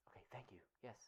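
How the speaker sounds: unvoiced aside to the producer; just breathe the thanks off-mic; whispered aside. Real, not acted.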